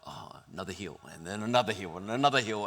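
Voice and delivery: low, sinking dismay; weary groan. Retold or imitated. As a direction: sag at each new climb.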